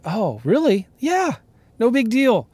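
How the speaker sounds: can't believe the deal is this easy; excited disbelief. Retold or imitated. Telling how the title company's okay made him giddy, excited disbelief. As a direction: pitch jumps way up, giddy and amazed. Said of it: "man, I was so excited".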